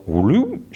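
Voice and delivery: a quick rising whoop sound effect; cartoon vanishing whoop. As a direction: swoop the voice up for a vanishing.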